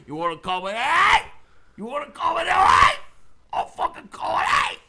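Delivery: high, shouted Cockney threats; escalating hammed-up Cockney yelling; Cockney challenge. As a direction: bellow for a fight in mock Cockney. Cockney character picking a fight.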